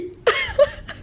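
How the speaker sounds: delighted high-pitched laugh; high burst of laughter. Real, not acted. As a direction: squeal of a laugh. Reacting to the bathroom.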